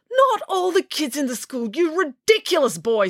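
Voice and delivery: high, sharp angry retort; Mister Lang snaps at Kieran; snapping. A narrator's reading. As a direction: snap at the boy. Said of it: "snapped Mister Lang".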